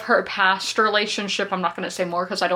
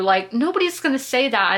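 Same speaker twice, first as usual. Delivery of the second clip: usual speaking voice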